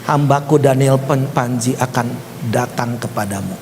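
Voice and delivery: low voice, softening at the end; solemn divine message. Retold or imitated. Preacher quoting God's word to the pastor, low and solemn. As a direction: deliver it low, like a prophecy.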